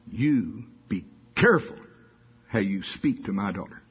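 a hint of a stern father-in-law, not lamb-like; low, lightly voiced warning to the son-in-law. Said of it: "not in a very lammy voice".

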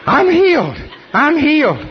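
high-pitched imitation of an exultant shout; excited cry. Man off the stretcher following the healer, crying out excitedly.

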